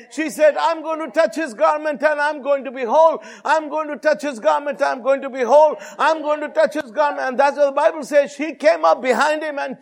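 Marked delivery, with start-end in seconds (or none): 0.5-7.3 high, driving retold affirmation; chant-like preacher's insistence, pitched up; insistent repeated declaration. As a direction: repeat it relentlessly, like a proclamation. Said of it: "So she kept on saying".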